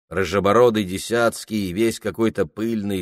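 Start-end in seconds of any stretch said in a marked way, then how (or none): none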